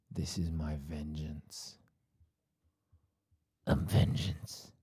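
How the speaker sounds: slow, low-key bad superhero voice; Batman. Host threatens vengeance on co-host, bad Batman voice. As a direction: drag it out as a poor caped-vigilante growl. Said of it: "worst Batman voice I've ever heard".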